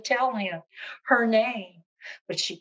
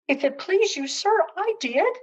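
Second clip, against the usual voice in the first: half pleased, half frightened; 10 semitones higher, 5 dB louder than usual. Acted. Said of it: "half pleased and also half frightened".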